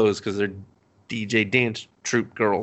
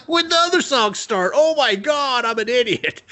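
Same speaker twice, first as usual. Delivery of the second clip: very high, louder, startled ditzy voice; mock dumb girl; 13 semitones higher, 3 dB louder than usual. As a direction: realise your blunder in shock. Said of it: "She's like".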